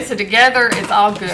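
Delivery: ordinary delivery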